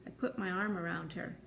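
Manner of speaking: ordinary delivery, nothing marked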